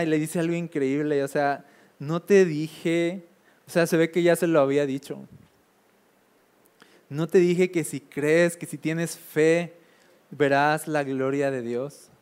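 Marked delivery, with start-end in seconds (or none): 2.0-3.2 slow solemn Jesus. Acted. Jesus quoted again. 7.1-12.1 slow solemn Jesus. Acted. Jesus quoted again.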